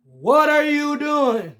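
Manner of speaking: high, loud and slow, yelling at the coach; exasperated shout. Real, not acted. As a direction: drag it out in disbelief, shouting.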